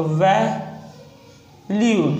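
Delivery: Valued split into "vai", long pause, "lyud"; sounded out slowly. Shown as a demonstration. Teacher breaking down a shorthand example, sounded out slowly. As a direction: say valued very slowly in two halves.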